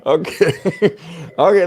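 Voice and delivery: laughing through okay; real laughter on okay. Real, not acted. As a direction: giggle through the words. Laughs after mic mixup.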